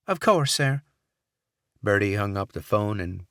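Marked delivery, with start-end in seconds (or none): 0.1-0.8 put-on Southern accent. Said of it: "saccharine Southern accent".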